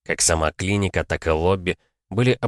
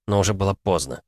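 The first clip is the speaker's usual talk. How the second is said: plain reading voice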